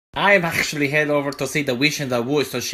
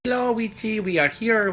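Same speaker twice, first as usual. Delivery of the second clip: higher, lilting call at the door; sing-song greeting; 9 semitones higher than usual. Acted. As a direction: call sweetly to the witch. Calling at witch's door.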